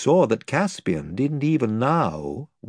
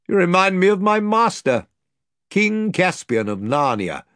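higher, louder kindly noble; reassuring lord; 7 semitones higher, 4 dB louder than usual. Acted. The lord reassuring Caspian he'll be treated well, kindly. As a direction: put the boy at ease.

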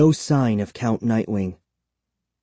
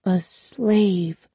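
high, drawn-out, tearful echo; wounded, high-pitched lament; trembling voice; 9 semitones higher than usual. A narrator's reading. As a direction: repeat it with a tremble. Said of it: "in a trembling voice".

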